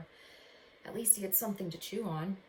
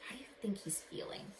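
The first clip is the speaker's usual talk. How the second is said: soft, unvoiced whisper; whispered question; 9 dB quieter than usual. Real, not acted. Teacher asking the children about Alan, whispered. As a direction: ask it in a whisper. Teacher asks the class softly.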